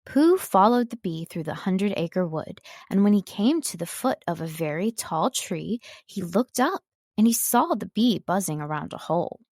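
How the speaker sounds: plain reading voice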